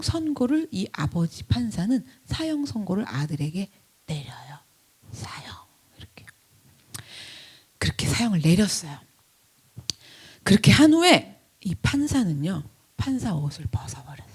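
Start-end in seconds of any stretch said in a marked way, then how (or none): 0.7-9.0 solemn whispered narration; hushed storytelling. Acted. Hushed telling of the sentence. 13.0-14.3 whispered. Acted. Whispered first take.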